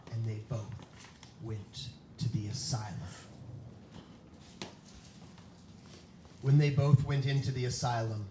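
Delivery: loud, dramatic storytelling voice; spooky story narration. Acted. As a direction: read it like a campfire ghost story. Reads kids' scary story aloud.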